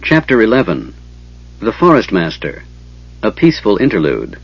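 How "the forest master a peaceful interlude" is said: ordinary delivery, nothing marked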